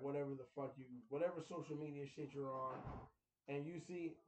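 usual speaking voice

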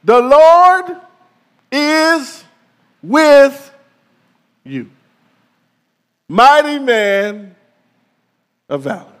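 loud, high, slow heavenly announcement; booming angelic greeting; angel's proclamation. Acted. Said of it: "the first words the angel says".

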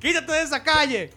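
put-on high yelling old woman; scolding neighbor. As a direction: shoo the kids off the street. (vecina regañona gritando)

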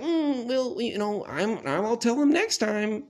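high, slow, coy mom voice. Retold or imitated. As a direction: voice mom putting it off, lilting high. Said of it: "she always was like".

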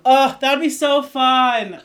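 loud, high, drawn-out delight; real gleeful exclamation; excited outburst. Real, not acted. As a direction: burst out thrilled, nearly laughing. Thrilled by the casting idea.

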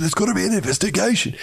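high, fast mocking whine; sarcastic imitation of rival teams insisting; mock demand. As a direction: parody the rivals demanding an inquiry.

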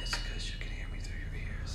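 very soft whispered explanation. Shown as a demonstration. Guest arguing the co-host hears without headphones, whisper. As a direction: whisper it, barely audible. Still testing the mic.

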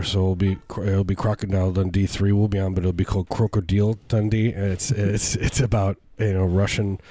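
usual speaking voice

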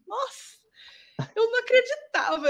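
high, bright exclamation of disbelief; excited disbelief. Retold or imitated. Telling the students how she couldn't believe it, excitedly. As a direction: relive the shock of passing. Recalling joy at passing.

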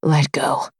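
low, dark command. Acted. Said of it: "he says darkly".

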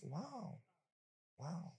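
high, soft, admiring compliment; sugary flattering voice; sweet-talking charmer. Retold or imitated. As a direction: coo it like a smitten suitor. Pastor acts himself flirting.

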